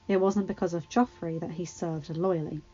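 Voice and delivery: ordinary delivery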